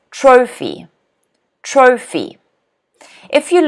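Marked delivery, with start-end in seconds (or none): 0.1-2.4 "Trophy" as TRO-phy, falling after the stress; stress on first syllable. Shown as a demonstration. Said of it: "one stress".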